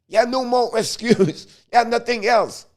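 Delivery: ordinary talk, nothing marked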